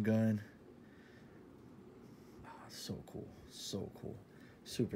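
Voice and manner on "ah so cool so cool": soft breathy whisper of awe; awed whisper. Real, not acted. Marveling at the clown shirt to viewers, whispering. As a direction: hush it like you can't believe it.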